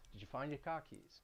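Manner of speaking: Boston, R dropped in car keys. Shown as a demonstration. Teacher demoing Boston car keys for learners. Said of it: "the R is gonna drop".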